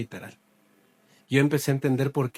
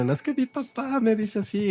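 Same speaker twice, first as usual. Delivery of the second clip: queja quejumbrosa; 9 semitones higher than usual. Retold or imitated. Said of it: "de las quejas que uno tiene".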